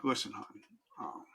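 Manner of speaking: soft, grave plea to his wife; quiet plea. Retold or imitated. Telling wife he may die.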